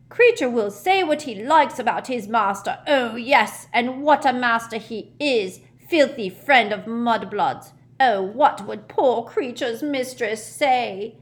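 high, loud, resentful elf voice; defiant house-elf. Acted. Kreacher ranting about his master mid-fight, spiteful. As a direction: sneer it out, stretching the last insults.

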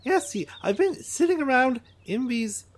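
excited alien Trixel. Acted. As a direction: greet him like an overeager little alien. Drawl rambles about housing.

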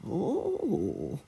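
high, swooping little oh; chirped. A narrator's reading. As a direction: let out a wondering bird-like oh. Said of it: "chirped the sparrow".

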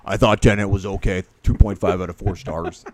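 distorted serial killer robot voice; calm psychopath. Pretend. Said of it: "in a distorted serial killer robot voice".

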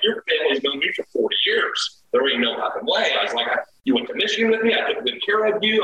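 acting out an affronted denial; retold indignant protest. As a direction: protest your innocence, voice climbing. Retelling his protest against accusation.

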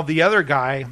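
ordinary talk, nothing marked

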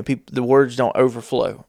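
normal talking voice

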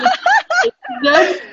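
high, loud peal of laughter; burst of laughter. Real, not acted. Guest hears her accent teased, real high laughter.

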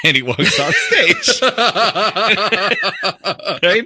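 laughing through the words, pitch jumping up; cracking up while telling it. Real, not acted. Laughing at his own story.

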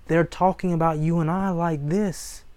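ordinary talk, nothing marked

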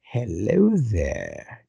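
low, slow hello there; Obi-Wan impression. Host greets in a low, slow Obi-Wan voice.

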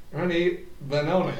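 low, soft, slow mock mumble; overheard mumble. Imagined masked stranger beside him on the bus, low hushed imitation. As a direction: imitate a stranger quietly intoning a name. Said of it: "I just hear".

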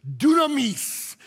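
"dunamis" said slowly as DOO-nah-mees, Greek style; Greek pronunciation. Shown as a demonstration. Pastor teaching the congregation the Greek word, slow careful pronunciation. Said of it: "pronounced in Greek".

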